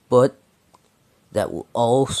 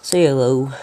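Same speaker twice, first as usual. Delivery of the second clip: ordinary delivery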